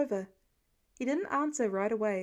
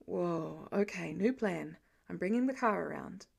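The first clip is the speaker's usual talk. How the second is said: a lower man's voice with a small jolt; lightly voiced Clint, startled; 5 semitones lower than usual. A narrator's reading. Clint as Pete reels, lightly lower and a bit startled. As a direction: catch yourself, then take charge.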